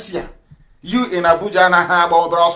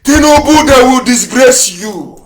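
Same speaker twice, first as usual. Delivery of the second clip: loud, higher, slow warning; warning to Tinubu; 6 semitones higher, 7 dB louder than usual. Retold or imitated. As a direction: call out a prophecy of disgrace. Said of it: "The same way I was telling Tinubu".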